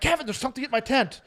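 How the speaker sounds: high-pitched alarmed call to a friend; panicked call out. Retold or imitated. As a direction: call out in a retold panic, voice jumping up.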